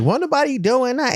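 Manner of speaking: lightly mimicked high-pitched protest; imagined girl's denial. Host imagining the girl denying it, high mock protest. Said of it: "she was probably like".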